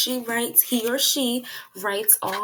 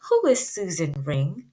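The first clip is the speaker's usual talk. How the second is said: ordinary talk, nothing marked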